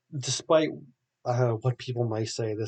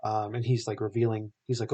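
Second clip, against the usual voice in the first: normal talking voice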